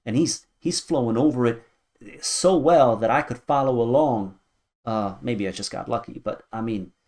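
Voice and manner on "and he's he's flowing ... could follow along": louder, higher burst of enthusiasm; real excitement, voice lifting; excited praise. Real, not acted. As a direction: gush about the flow, getting carried away. Hyped about the flow.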